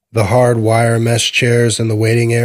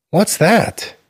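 put-on high, bright child's question; curious little boy; 9 semitones higher than usual. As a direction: ask it like an eager four-year-old. Curious boy points at tattoo.

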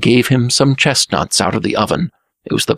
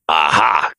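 triumphant croak; 4 semitones lower than usual. A narrator's reading.

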